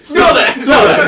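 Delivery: loud, high disgusted chef; disgusted by smell. Retold or imitated. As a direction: shove the smell at them, loud.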